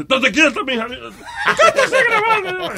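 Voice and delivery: high, drawn-out comic yell; mock scolding shout. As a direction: yell at her to hold still. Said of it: "y uno le dice".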